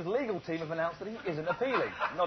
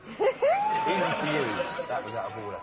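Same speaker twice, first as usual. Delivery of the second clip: laughing. Real, not acted. Host reacting to co-host's joke.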